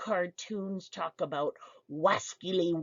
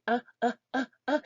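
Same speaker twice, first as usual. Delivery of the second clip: put-on higher, wordless "uh" grunts like a toddler reaching; short higher pleading grunts; pointing toddler grunts; 6 semitones higher, much faster than usual. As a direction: imitate a two-year-old with no words demanding something. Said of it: "young children ... pointing, going".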